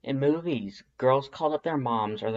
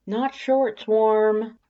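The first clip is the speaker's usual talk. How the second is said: higher, slow, deliberate protest; 11 semitones higher, 7 dB louder, much slower than usual. A narrator's reading. To Undyne about the outfit, slow higher protest. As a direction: drag it out, mildly insistent. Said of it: "you say".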